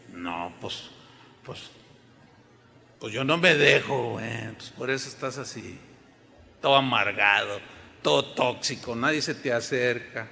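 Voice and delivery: low sullen voice of a stubborn believer; resentful tough guy. Acted. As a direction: play a bitter man who won't back down.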